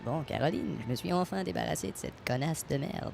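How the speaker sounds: deep, warped voice like a frog; voice changer. Acted. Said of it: "ta voix sonne comme une grenouille".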